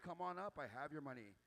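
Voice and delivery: ordinary delivery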